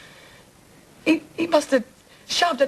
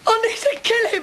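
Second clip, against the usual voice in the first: high, overwrought cry of rage; furious outburst; 8 semitones higher than usual. Acted. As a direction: burst out in anguished fury, pitch up high. Furious outburst at her attacker.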